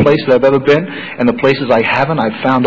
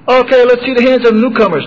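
mock meeting chair; 8 semitones higher, 3 dB louder than usual. Chair calls on newcomers.

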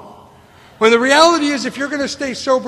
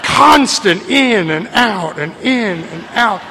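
slow, louder, exaggerated breathing imitation; mocking the breathing; 5 dB louder, slower than usual. Newcomer irritated by a neighbour's breathing, slow mock imitation. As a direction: drag it out to mock the annoying breathing.

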